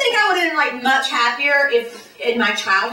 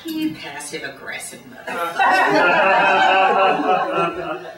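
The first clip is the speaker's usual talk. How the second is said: slow mock outrage; 5 semitones lower, much slower than usual. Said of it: "I'm like".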